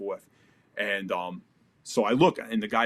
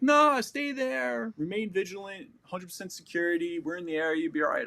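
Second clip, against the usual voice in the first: radio commander voice; 8 semitones higher than usual. Retold or imitated. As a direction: lay on the bored officer brushing him off. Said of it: "And he's like".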